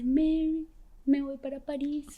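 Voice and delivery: high, softened mock crying; imitated tearful voice. Girl from Paris tells her bad news, imitated tearful voice. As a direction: retell it as a plaintive high whimper. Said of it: "llorando, una vez más".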